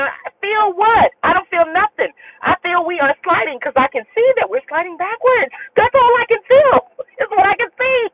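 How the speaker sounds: high retold protest, climbing as it goes; incredulous. Passenger disputing the driver's claim of traction, incredulous. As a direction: push back in comic alarm, ending in a laugh.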